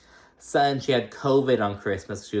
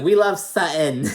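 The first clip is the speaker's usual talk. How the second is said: gushing fan; 7 semitones higher, 6 dB louder than usual. Retold or imitated. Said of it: "I was like".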